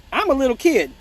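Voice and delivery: usual speaking voice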